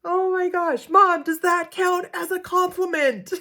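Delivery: put-on high, loud, laughing child voice; excited kid. Son asking mom if it counts, high thrilled laughing voice. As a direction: squeal it like a thrilled little boy. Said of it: "Joey just goes".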